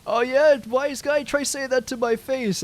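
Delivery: hammed-up street thug taunt; tough guy retort. Gunman retorts, provoked.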